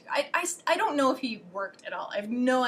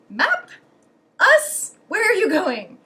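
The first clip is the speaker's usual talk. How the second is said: high, loud single-word questions; mock lost foreigner, pitched way up; exaggerated loud, questioning tourist act; clueless foreigner; 8 semitones higher, 9 dB louder, slower than usual. Acts out asking with no language.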